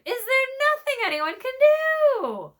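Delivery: very high, loud desperate bird voice; pleading high raven. Acted. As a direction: beg for help, squawking high.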